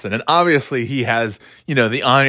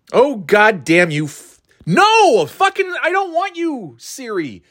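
real frustrated outburst; 10 semitones higher, slower than usual. Real, not acted. Shouting at Siri who popped up uninvited, exasperated. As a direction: blurt at Siri in annoyance.

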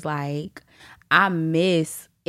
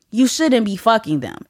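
usual speaking voice

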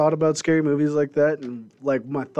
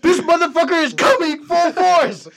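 much higher, louder frantic yell; panicked shouting; 13 semitones higher, 5 dB louder than usual. Pretend. Child warns mom about the monster, mock panicked shouting. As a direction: scream the warning in mock terror.

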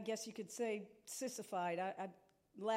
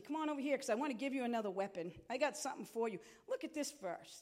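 ordinary delivery

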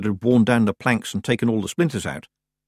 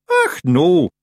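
higher, louder Scots creature voice; Scottish Feegle; 8 semitones higher, 7 dB louder than usual. A narrator's reading. Feegle answering from under the bed, loud Scots denial. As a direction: protest as a little Scottish Feegle.

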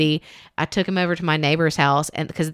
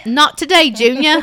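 playful catchphrase; 8 semitones higher, 6 dB louder than usual. Pretend.

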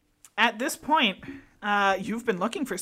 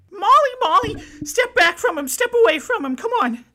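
high, loud panicked calling; Stacy shouting in fear; frantic pleading; 11 semitones higher, 9 dB louder than usual. Acted. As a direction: beg her to step back. Stacy still freaking out.

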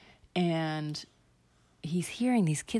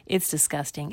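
ordinary delivery, nothing marked

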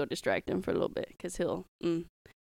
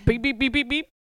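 imitated beeping; 3 semitones higher, 5 dB louder than usual. Joking about handing out parking tickets, imitated beeping. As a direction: beep like a ticket machine.

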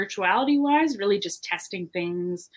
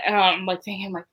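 usual speaking voice

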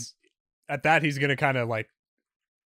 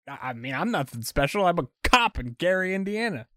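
captive cop, a bit higher and placating; nervous cop; 9 semitones higher, 3 dB louder than usual. Acted. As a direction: soothe the kidnapper, rising okays. GM voices cop questioning.

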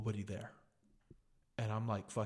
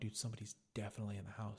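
hushed alarm; 8 dB quieter, much faster than usual. Retold or imitated. Scared reaction to intruder, retold.

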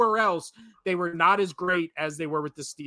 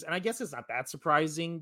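normal talking voice

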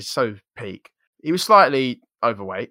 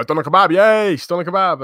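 higher and louder, a sing-song hail; mock cheerful greeting; 6 semitones higher, 6 dB louder than usual. Sixth formers greet the kid by his nickname, gleeful. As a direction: greet him like a mascot arriving. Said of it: "they go".

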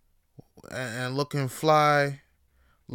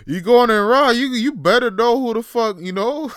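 louder and higher, half laughing; loud laughing repeat; 9 semitones higher, 10 dB louder than usual. Real, not acted. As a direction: blurt it again with a laugh. Repeats the line, bursting out.

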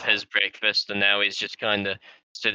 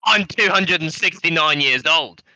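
indignant boast; 6 semitones higher, 8 dB louder than usual. Acted. Offended old character boasting.

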